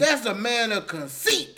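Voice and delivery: high, slow, emphatic shout; loud accusation. Real, not acted. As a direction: hammer the charge home loudly. Heated accusation in banter.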